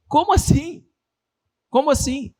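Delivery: high, baffled double take; can't believe what he just heard; incredulous. Real, not acted. Speaker reacting to the flat pep talk, incredulous.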